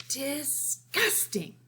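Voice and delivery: slow, drawn-out disgusted whisper. A narrator's reading. Lily muttering at her doting parents, whispered disgust. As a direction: breathe it out in slow disdain.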